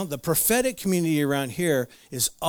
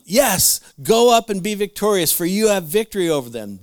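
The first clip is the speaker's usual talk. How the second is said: loud, high mock encouragement; sarcastically grand proclamation; sarcastic; 6 semitones higher, 6 dB louder than usual. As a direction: cheer them on with hammed-up sarcasm. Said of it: "he said sarcastically".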